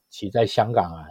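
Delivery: ordinary talk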